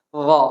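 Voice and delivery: "Dho" with a thick, heavy, rounded sound. Shown as a demonstration. Teacher models the heavy dho letter. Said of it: "ditebel sama tipis".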